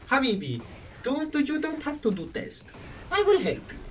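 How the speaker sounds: reassuring teacher in a hammed-up Middle Eastern accent; thick Middle Eastern accent. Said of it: "very thick Middle Eastern, like, accent".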